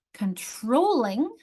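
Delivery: "Controlling" slowly, stretching TROL; slow con-TROL-ling for "Controlling"; second-syllable stress. Shown as a demonstration. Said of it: "my accent's here".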